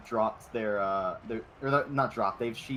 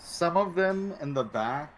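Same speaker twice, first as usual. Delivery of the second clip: ordinary talk, nothing marked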